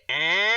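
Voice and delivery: long, held mock siren noise; imitated siren blare. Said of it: "that siren will, will blow".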